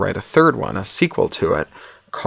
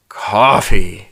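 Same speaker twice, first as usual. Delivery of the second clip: fervent, louder one-word plea; 6 dB louder than usual. Acted. Hungover Hugh seizes on the offer of coffee, fervent. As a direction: say it with longing, pushed out louder. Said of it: "Hugh says fervently".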